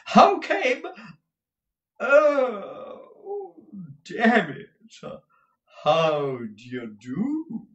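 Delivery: loud high outburst easing into a slow drawl; flustered, affected husband. Acted. Husband catching sight of Kate, loud cry melting into drawl. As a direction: start startled, then slide into oily greeting.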